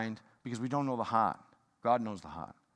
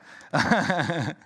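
laughter; 2 semitones higher, 6 dB louder than usual. Real, not acted. Laughs at own joke.

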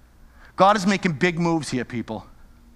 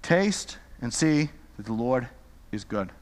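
lower, softer and slow, dropping away; soft scripture reading; 9 semitones lower, 7 dB quieter, slower than usual. Acted. Preacher quoting the psalm to the congregation, hushed and slow. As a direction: let the verse fall to near silence.

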